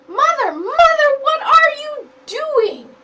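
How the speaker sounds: high, loud, slow panicked call to mother; a chick crying out in alarm; frightened cry. Acted. Chick sees siblings pushed.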